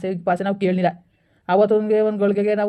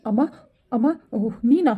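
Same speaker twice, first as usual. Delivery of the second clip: higher startled greeting; surprised son; 5 semitones higher than usual. Acted. Son startled to find his mother at the door. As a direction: caught off guard by mom.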